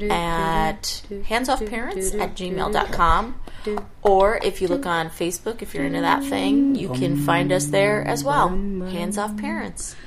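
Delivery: slow, clear announcer read; measured outro voice; podcast outro read. Acted. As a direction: read the sign-off like an ad.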